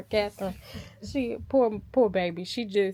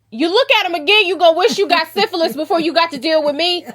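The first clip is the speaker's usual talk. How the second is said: loud, much higher, fast jealous warning; jealous threat; 7 semitones higher, 10 dB louder, faster than usual. Retold or imitated. The jealous wife warns the brothel girls off her man, loud and fierce.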